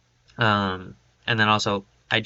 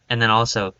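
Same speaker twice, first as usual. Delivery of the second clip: normal talking voice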